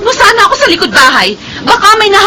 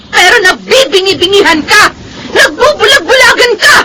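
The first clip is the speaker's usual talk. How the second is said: tearful accusation. Acted. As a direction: scold her, near tears. Sister accusing her sister. (umiiyak at galit na paninisi)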